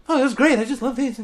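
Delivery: high, bright-eyed mock cheer; chipper colleague. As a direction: imitate an over-happy new teacher, pitched high. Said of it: "he's a really chipper guy".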